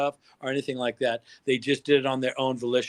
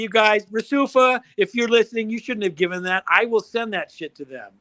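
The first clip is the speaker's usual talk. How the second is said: normal talking voice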